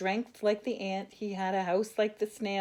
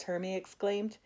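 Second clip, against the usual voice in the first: plain reading voice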